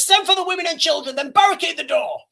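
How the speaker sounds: high, loud urgent command; commanding order. Retold or imitated. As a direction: bark the order as Aragorn. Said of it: "He says".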